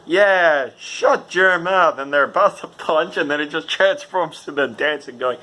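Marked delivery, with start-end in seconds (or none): 0.1-2.0 mock tough. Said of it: "I said".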